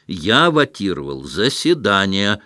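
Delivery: plain reading voice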